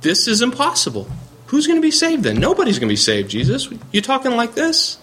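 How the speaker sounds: high, pleading disbelief, words climbing up; a dismayed disciple crying out who can be saved; despairing Peter. Acted. The teacher voicing Peter in high despair to Jesus. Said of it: "you're feeling like Peter in Matthew nineteen".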